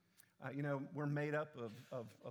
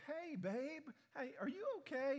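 higher, lightly voiced worried wife; imitating wife's concern; 9 semitones higher than usual. Wife asks her husband if he's okay, higher concerned voice. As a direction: play the caring wife checking in. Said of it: "Yvette was coming up to me and going".

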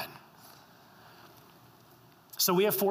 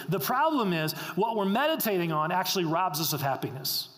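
ordinary delivery

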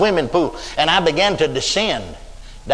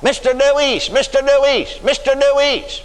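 high called-out name, voice raised; calling out; 8 semitones higher than usual. Retold or imitated. Heavenly voice calling the dying man back. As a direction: call his name from a distance. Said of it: "somebody hollering".

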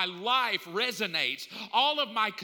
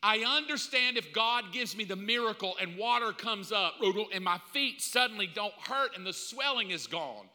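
ordinary delivery

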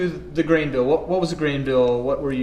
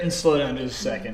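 ordinary delivery

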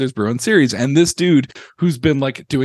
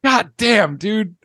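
excited; 7 semitones higher than usual. Real, not acted. Celebrating the team's playoff win.

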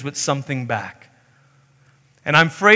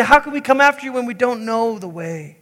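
bewildered disciples; 6 semitones higher than usual. Acted. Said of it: "And they say".

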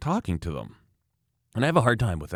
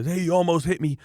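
higher-pitched mock complaint; complaining skier; 8 semitones higher than usual. Oversensitive skier complaining at a near miss, mock indignant. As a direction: imitate an indignant skier calling out.

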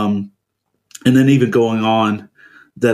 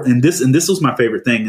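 usual speaking voice

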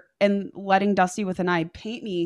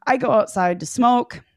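ordinary talk, nothing marked

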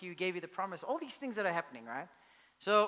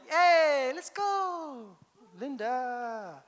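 mock call; 5 semitones higher, 6 dB louder, much slower than usual. Abraham called out jokingly.

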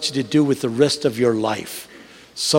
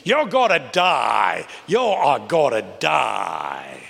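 slow, drawn-out solemn warning; slow pastor warning; much slower than usual. Retold or imitated. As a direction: stretch each word like the old pastor. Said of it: "He talks like that".

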